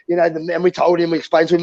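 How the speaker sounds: usual speaking voice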